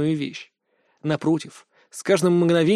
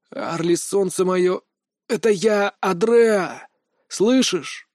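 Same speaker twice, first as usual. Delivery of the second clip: tender coaxing; 3 semitones higher, slower than usual. A narrator's reading. Coaxing a frozen child awake.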